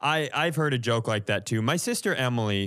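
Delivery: usual speaking voice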